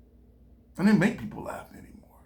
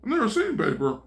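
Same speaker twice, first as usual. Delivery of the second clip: amazed villagers; 5 semitones higher than usual. Retold or imitated. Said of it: "The people were like".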